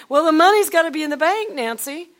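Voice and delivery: a high, loud, insistent husband; husband's voice. Retold or imitated. Husband insisting on money first, in a high, loud voice.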